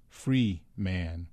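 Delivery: "Free man" said as two distinct, measured words; each syllable of "Free man" a statement in itself; syllables separated. A narrator's reading. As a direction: stop between "Free" and "man". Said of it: "He pronounced the syllables separately, distinctly".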